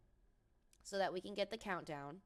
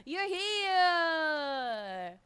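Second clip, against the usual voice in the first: much higher, louder hail; excited welcome; 10 semitones higher, 10 dB louder, much slower than usual. Real, not acted. Streamer welcoming a viewer who arrived, excited. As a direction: call out the viewer's name gladly.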